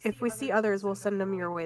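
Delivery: ordinary delivery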